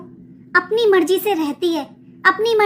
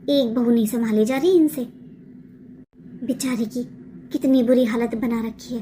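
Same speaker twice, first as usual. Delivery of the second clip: a much lower voice, gossiping with pity; gossiping neighbour; 10 semitones lower, 4 dB quieter than usual. Acted. Second neighbour tutting about the bahu, low gossipy voice. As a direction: cluck over the poor daughter-in-law, voice dropped low.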